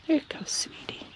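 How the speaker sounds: hushed whisper to a shy bunny; gentle breathy praise. Real, not acted. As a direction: whisper it so she isn't scared. Soothing a pet bunny.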